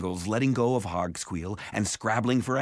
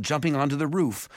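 plain reading voice

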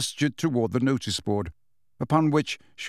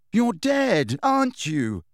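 exasperated; 8 semitones higher, slower than usual. A narrator's reading. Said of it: "said Harry exasperatedly".

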